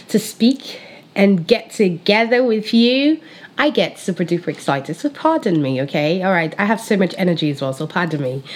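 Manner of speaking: ordinary talk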